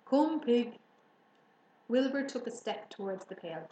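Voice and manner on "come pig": higher, louder animal call; coaxing farmer. A narrator's reading. Farmer luring pig with slops, coaxing. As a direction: call the pig over to the pail. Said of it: "said Mister Zuckerman".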